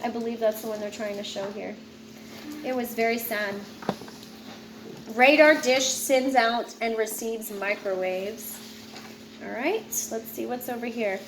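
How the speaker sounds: ordinary delivery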